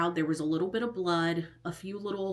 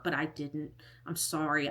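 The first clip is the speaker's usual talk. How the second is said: ordinary talk, nothing marked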